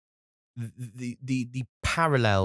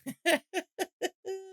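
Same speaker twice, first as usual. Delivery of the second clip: high laugh. Real, not acted. Laughing at own impression.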